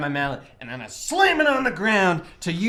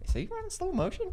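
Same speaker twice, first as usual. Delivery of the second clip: high, airy teasing question; 11 semitones higher, 14 dB quieter, faster than usual. Real, not acted. Teasing a teammate who runs like Baywatch, high airy question. Mocking teammate's slow-mo run.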